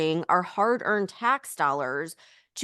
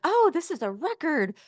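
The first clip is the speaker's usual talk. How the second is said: mock gushing; 7 semitones higher than usual. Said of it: "it's like".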